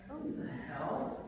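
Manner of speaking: high, slow disbelief; shocked disgust. Acted. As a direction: recoil at the glove. Finds a wet latex glove.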